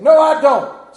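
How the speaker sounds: loud protest. Retold or imitated. Husband denying his accent to his laughing wife, loud. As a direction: loudly deny it. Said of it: "denying to his wife".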